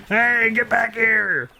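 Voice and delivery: high, slow drawn-out yell; shouting after someone. Acted. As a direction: call out as he runs off. Yelling after fleeing man.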